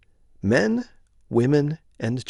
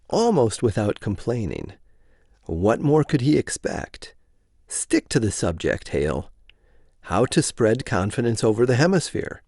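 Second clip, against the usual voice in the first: plain reading voice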